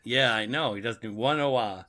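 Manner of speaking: ordinary talk, nothing marked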